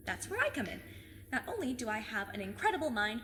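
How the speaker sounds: ordinary talk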